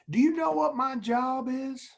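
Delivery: a retold drill instructor's Southern accent, heightened; deep Southern accent. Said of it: "in his deep Southern accent".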